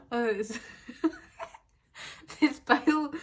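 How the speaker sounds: real laughter breaking up the words; laughing too much. Real, not acted. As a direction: try to talk through a giggle fit. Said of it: "I need to stop laughing so much".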